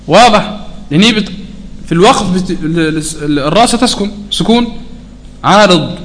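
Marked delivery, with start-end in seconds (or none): none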